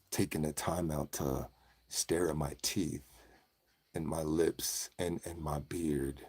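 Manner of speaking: very soft, low and halting; hushed low voice. Pretend. Host thanking admirers of his teeth, hushed low voice. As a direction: murmur humble thanks, deep and hushed. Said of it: "The normal voice is out".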